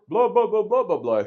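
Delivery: high, loud mock babble; loud, high filler talk; loud attention-getting chatter. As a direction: blurt it out loudly to turn heads. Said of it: "he said something loud".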